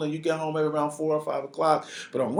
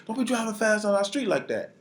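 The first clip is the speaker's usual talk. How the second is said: retold scream; 9 semitones higher than usual. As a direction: imitate hollering from the porch at a driver. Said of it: "we screaming".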